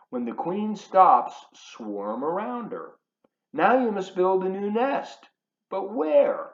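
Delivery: put-on lively picture-book read-aloud to kids; reading to children. Reading the bee story aloud to the children, animated.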